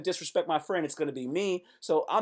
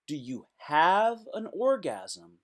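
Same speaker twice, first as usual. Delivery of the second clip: slow mock profound; 3 semitones lower, slower than usual. Shown as a demonstration. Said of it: "philosophical line said twice".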